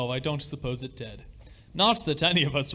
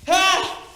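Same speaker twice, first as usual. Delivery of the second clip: sudden high shriek of fright, much louder; startled scream; 7 dB louder than usual. Acted. Father feels the paw move after the wish, startled shriek. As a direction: cry out as the paw jerks in your grip.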